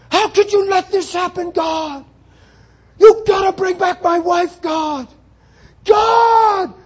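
loud, high anguished yelling at God; a man calling out to the ceiling, lost; desperate cry to God. Retold or imitated. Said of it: "screaming like a madman".

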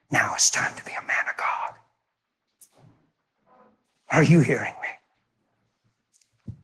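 dropped to a whisper to pierce the crowd; hushed urgent whisper. Real, not acted. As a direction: whisper it, slow and intense. Preacher drops to whisper.

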